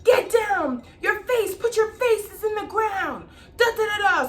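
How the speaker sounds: high barked command to get down; yelling order. Retold or imitated. Reenactor yelling at children to get down, shouting. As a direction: yell at the kids like a drill sergeant. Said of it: "all we hear is".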